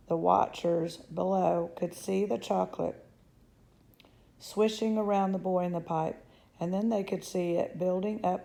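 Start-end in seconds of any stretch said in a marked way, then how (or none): none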